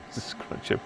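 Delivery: soft mock whisper of the name. Hiding plans from his wife, a soft mock whisper. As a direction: whisper it so she can't hear.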